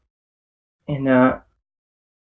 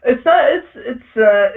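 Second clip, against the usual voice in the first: laughing; 7 semitones higher, much slower than usual. Real, not acted. GM laughs at intimidation roll.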